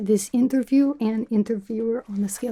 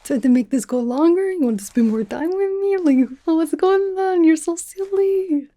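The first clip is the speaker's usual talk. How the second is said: high, louder teasing, slowing at the end; teasing flirt; 6 semitones higher, 4 dB louder than usual. Retold or imitated. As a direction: coo at her like she's adorable. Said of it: "And I was like".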